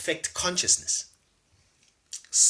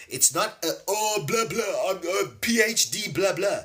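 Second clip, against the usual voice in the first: high-pitched mock-learned blather; mocking academic talk; 6 semitones higher than usual. Dismissing PhD talk to the audience, in a high mocking voice. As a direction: make the jargon sound silly, pitched well up.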